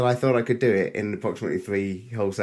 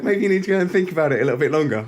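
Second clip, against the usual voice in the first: mum's reply; 6 semitones higher, 6 dB louder, much faster than usual. Retold or imitated. Mum sending him back to think.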